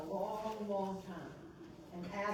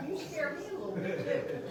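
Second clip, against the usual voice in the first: laughing; 5 semitones higher, 6 dB louder than usual. Real, not acted. Teasing reply to Jeff.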